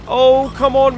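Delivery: ordinary delivery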